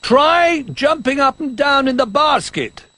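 put-on Old Bear calling up anxiously. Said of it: "he called".

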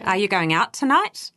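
"tonight" lifted at the end, a clear question rise; rising question tune. Shown as a demonstration. Coach showing newcomers how a question goes up. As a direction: let "Are you going out tonight?" climb up on "tonight". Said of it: "a question goes up at the end".

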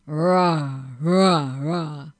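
loud roar, fading on the last; bear roar. Acted. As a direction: answer with three bear roars. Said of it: "What did that sound like?".

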